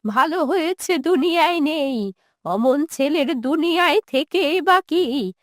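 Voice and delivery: put-on quavering old mother, bitterly rejecting her son; wounded, resentful old woman. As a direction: refuse to hear of him, words drawn out.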